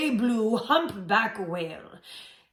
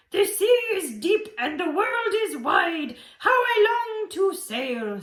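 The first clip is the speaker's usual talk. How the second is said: high, wistful little snail; tiny snail voice; 10 semitones higher, 4 dB louder than usual. Acted. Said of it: "she sniffed and sighed".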